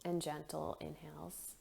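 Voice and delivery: soft, unhurried meditation voice with long pauses; guided meditation. Acted. Leads a guided breathing meditation.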